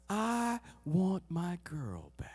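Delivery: soft, low and very slow; tender longing. Acted. God still wants his straying girl, slow quiet longing. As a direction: let each word sink down gently. God's longing declaration, slowed down.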